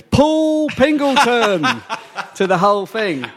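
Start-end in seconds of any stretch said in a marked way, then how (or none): 0.0-1.7 high, slow drawn-out announcement of the name; announcer mispronouncing. Retold or imitated. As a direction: boom it out like a toastmaster announcing a guest. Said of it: "He goes".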